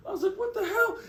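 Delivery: high, laughing "what the hell"; retelling being chased, laughing in disbelief. Retold or imitated. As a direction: squeak it out in amused shock. Chased by a big guy.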